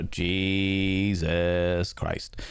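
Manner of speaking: very slow, low exclamation; drawn-out dismay. Real, not acted. Streamer hearing of minus twenty-five tundra, drawn-out dismay. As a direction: stretch each syllable out, low and appalled.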